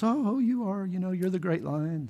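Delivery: timid high-pitched bunny reply; bunny rabbit. Acted. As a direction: answer as a small cute rabbit, quick to agree. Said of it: "And the bunny rabbit goes".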